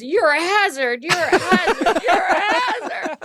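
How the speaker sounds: mocking echo that climbs each time; haunting echo. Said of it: "all I can think about is".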